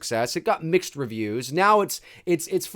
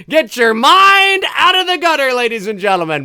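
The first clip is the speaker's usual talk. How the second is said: playful scolding; 9 semitones higher, 8 dB louder than usual. Acted. Jokingly scolds viewers after innuendo.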